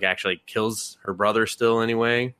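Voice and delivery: ordinary talk, nothing marked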